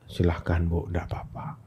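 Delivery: very soft, low voice of a son; calm, gentle reply. Retold or imitated. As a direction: quietly let mother have her way. Son answers mother's starvation threat calmly. (jawaban anak, lirih dan tenang)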